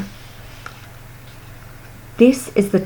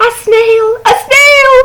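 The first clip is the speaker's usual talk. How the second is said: alarmed cry; 13 semitones higher, 4 dB louder than usual. Acted. Snail spotted on the board.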